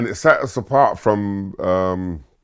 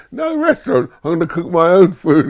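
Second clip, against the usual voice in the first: much higher and louder, brash; cocky teenage comeback; 10 semitones higher, 7 dB louder than usual. Retold or imitated. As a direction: toss it back with a cheeky squeal. Son's cocky answer to dad.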